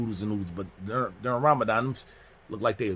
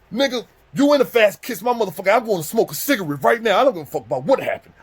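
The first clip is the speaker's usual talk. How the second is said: high, loud defiant rant; furious outburst; 10 semitones higher, 7 dB louder than usual. Retold or imitated. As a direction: yell that you are done with the rules. Friend's furious outburst acted out.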